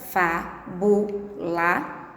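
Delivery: "fá, bu, lá" said as three separate syllables. Shown as a demonstration. Teacher shows the class how fábula splits, spelled out. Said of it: "separar as sílabas".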